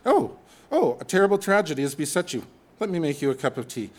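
hammed-up British sympathy; mock British. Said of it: "We've watched enough British television".

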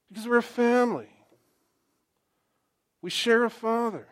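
preacher rejoicing with the church family; excited preaching. Acted. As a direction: celebrate the choir together. Preaching, excited about choir.

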